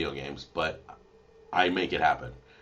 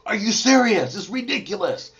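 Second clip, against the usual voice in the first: frustrated son; 7 dB louder than usual. Retold or imitated. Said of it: "He's like".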